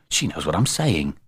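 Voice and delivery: quiet musing; thinking to himself. A narrator's reading. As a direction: say it softly as a private thought. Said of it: "thought Jeremy James".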